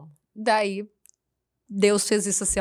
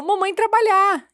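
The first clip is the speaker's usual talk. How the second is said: put-on high, loud, slow toddler quote; 11 semitones higher, 9 dB louder than usual. Mother imitating her son's toddler words on video. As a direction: play the little boy repeating his line. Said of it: "No vídeo ele falou".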